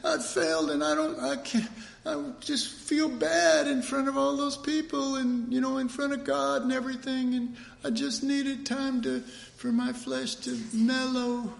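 high, pouting mock whine about skipping church; whiny excuse. Sinner whines to spouse about skipping church, high mock pout. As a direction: whine the excuse like a sulky kid.

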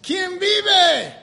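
high, loud church call-out; loud yell. Retold or imitated. Imitating an excited Mexican churchgoer during service, yelling out real loud. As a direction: yell it across a whole congregation. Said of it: "will yell out real loud".

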